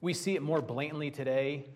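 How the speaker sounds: ordinary talk, nothing marked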